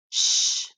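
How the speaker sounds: a long, voiceless "Sh"; isolated sh sound. Shown as a demonstration. Teacher models a sound for children to echo, isolated sh. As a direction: hold "Sh" as one steady hushing sound. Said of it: "Next sound".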